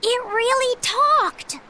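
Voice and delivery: high, drawn-out astonishment; amazed. Acted. Traveller hearing a penguin talk, amazed. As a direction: marvel at it, slow and high.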